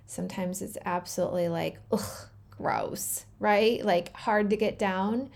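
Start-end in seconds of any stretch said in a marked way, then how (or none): 1.9-3.2 low, breathy voiceless groan of disgust; disgusted ugh. Acted. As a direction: recoil as if tasting something foul. Describing medicine that tastes bad.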